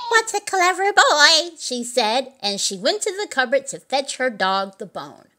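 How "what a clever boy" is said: high, loud and tickled; delighted praise. A narrator's reading. Old Mother Hubbard cheering her dog's cartwheels, high delight. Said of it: "Old Mother Hubbard laughed".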